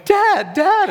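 put-on high, loud child's cry of "Dad"; high childish yell for Dad; kids crying for dad. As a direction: call out like a panicked child.

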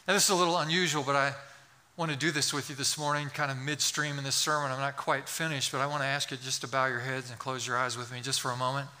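usual speaking voice

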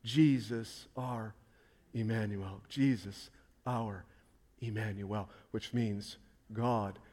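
quiet hushed Emmanuel; hushed reverence. Acted. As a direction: let it fall quiet and tender. Tender close of sermon.

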